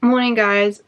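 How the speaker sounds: usual speaking voice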